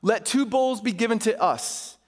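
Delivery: measured, emphatic public Bible reading; Scripture reading. A narrator's reading. Pastor reading Elijah's showdown to his church, emphatic Scripture reading. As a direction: read it out formally, phrase by phrase.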